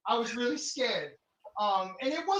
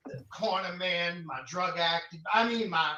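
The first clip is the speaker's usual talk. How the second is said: normal talking voice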